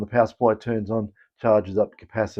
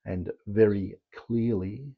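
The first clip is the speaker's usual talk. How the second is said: slow, soft Queen's English; very slow, careful Queen's English; 9 dB quieter, slower than usual. Shown as a demonstration. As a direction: speak the Queen's English very clearly and quietly. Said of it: "speak the Queen's English and speak very slowly".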